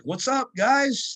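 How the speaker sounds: high, slow, drawn-out hello to the gym guys; mock friendly greeting. Lifter retelling his greeting to gym staff, hammed-up cheery. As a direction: greet them like an overeager visitor.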